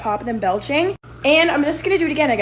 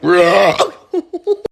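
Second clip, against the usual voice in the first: loud laugh; 4 semitones lower, 8 dB louder than usual. Real, not acted. Laughs after throwing up.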